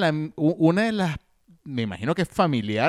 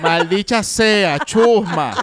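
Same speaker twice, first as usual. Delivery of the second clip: slow, drawn-out mock curse; imitated shouting; 3 semitones higher, 5 dB louder, much slower than usual. Host re-enacting the old Maldicha Sea section, imitated shouting. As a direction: bellow it in comic outrage. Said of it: "se inspiraba en los gritos que pegaba".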